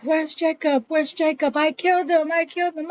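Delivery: high-pitched, frantic retelling of a panicked mother; panicking mother. Retold or imitated. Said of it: "start panicking".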